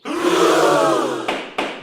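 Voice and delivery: sharp shocked gasp of breath; a loud theatrical gasp; hammed-up gasp of shock. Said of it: "No gasping".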